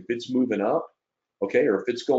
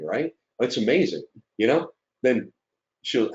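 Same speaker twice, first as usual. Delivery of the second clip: usual speaking voice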